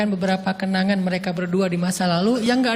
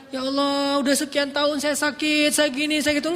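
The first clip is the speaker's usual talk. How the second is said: higher, whining list of grievances; complaining prayer; 4 semitones higher than usual. Shown as a demonstration. The wrong way to pray to Allah, complaining. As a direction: pray like you're grumbling to God.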